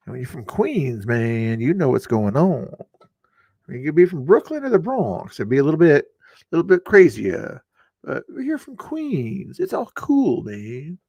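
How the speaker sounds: mock laid-back New York guy accent; terrible New York accent. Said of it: "that was a terrible accent".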